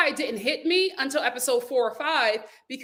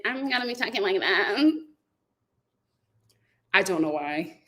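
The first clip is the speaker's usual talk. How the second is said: changed voice; 5 semitones lower than usual. Pretend. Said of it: "go back to my real voice".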